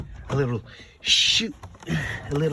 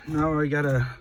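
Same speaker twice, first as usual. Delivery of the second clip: ordinary talk, nothing marked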